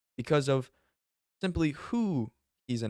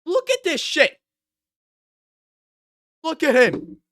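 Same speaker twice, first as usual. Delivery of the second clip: much higher and louder, almost squealing at the screen; loud high disbelief; 10 dB louder than usual. Real, not acted. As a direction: yell at the screen, pointing and laughing.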